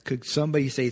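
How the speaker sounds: ordinary delivery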